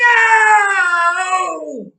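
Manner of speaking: high, loud piercing shriek; ear-splitting high scream of no. A narrator's reading. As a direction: shriek no with everything. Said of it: "shrieked Shirley".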